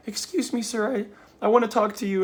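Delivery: high, timid voice of a frightened woman; imitating meek, frightened woman. Scared woman approaching a stranger in a parking lot, imitated meekly. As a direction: mimic her meek plea, pitched well up. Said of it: "really meekly, terrifyingly like".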